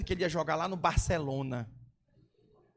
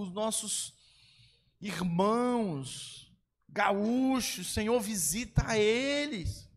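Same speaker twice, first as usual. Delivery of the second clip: earnest prayer, pleading to the Lord; inner prayer cry. Shown as a demonstration. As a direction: pray it out as a cry held inside. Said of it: "Gritar aqui dentro". (oração clamando ao Senhor por dentro)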